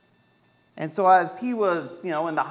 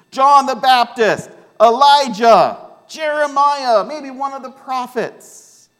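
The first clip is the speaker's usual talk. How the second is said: higher, louder eager answers; disciples calling out; 8 semitones higher, 7 dB louder than usual. Acted. Disciples answering Jesus, higher and louder. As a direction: call out the guesses eagerly.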